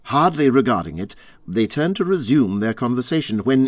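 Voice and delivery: plain reading voice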